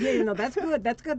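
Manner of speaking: laughing loudly through the words. Real, not acted. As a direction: laugh it out, amused. Host laughing at guest's remark.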